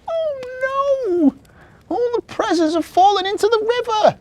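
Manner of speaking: very high, loud elf wail; distressed cry. A narrator's reading. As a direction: cry out in horror as a little elf. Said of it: "Marzy cried".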